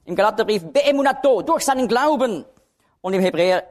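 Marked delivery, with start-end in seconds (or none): 0.6-2.4 Beemunato and Glauben pitched high; stress on Beemunato. Shown as a demonstration. Preacher marking the accent in Galatians, stress on Beemunato. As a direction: hit Beemunato, keep Glauben raised. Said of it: "jedes Mal wird der Akzent auf ein anderes Wort gelegt".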